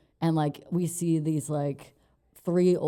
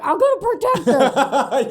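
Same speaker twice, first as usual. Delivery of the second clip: much higher, louder, slow hammed-up vow through laughter; boyfriend's mock-brave pledge to the dad; mock heroic promise; 10 semitones higher, 9 dB louder, slower than usual. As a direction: puff up like a gallant protector, laughing.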